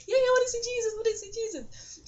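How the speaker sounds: high, eager squeal; excited. Retold or imitated. Asking the girl to see Jesus, high excited squeal. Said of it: "I'm starting to get really excited".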